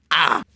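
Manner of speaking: frustrated yell. Acted. Frustrated outburst.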